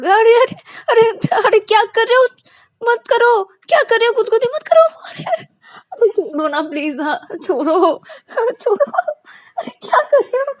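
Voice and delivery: high, loud squealing laughter-protest; being tickled. Acted. Payal being tickled by Ananya, squealing with laughter. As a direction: shriek and giggle while being tickled. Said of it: "गुदगुदी देने लगती है".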